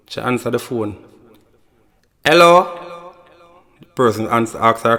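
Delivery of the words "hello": high, put-on girlish hello; girlfriend answering. Girlfriend picking up her lover's call, high girlish voice.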